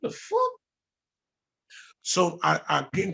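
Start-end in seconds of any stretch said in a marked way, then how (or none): 0.0-0.5 high, startled "the fuck?"; stunned, not angry; a high squeak of disbelief; baffled. Retold or imitated. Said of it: "not angry, but I'm like".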